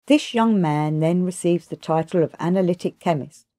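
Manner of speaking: plain reading voice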